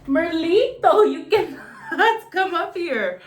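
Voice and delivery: real exasperated yell at the pet; high loud scolding. Real, not acted. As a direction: shriek at the pet to get down, laughing. Scolding pet again, much higher.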